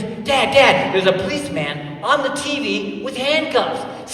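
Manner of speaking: put-on high, eager child's call to Dad; excited little boy. Four-year-old son telling his dad about the TV, excited. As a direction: imitate your small son bursting with news. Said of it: "He was so excited".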